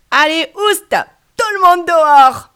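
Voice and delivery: loud, high shout; shouting them out. Acted. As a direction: chase them all out at full voice. Child chasing everyone out.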